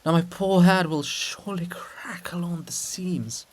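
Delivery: hushed unvoiced whisper. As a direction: whisper it to yourself. Grumbling monument in the night.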